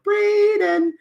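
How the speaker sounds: very high, loud puppet voice; puppet's encouraging voice. Acted. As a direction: squeal it sweetly high like a puppet. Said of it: "she'll go like".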